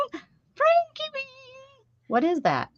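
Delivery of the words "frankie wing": high silly voice. Pretend. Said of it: "trying name variants".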